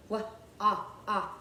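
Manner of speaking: W as "wuh", then "ah" separately; isolated sounds. Shown as a demonstration. Teacher finding the next sound in walks, separate short sounds. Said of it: "The next sound is ah".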